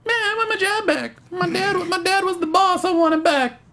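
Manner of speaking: high, whiny entitled voice; naive Danny. Retold or imitated. Host voicing naive Danny at his old company, high and whiny. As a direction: play a naive kid demanding his job.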